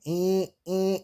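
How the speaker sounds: high mock alarm beeps; playful high beep imitation; machine beeping. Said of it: "the machines start going".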